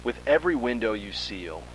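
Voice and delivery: ordinary delivery, nothing marked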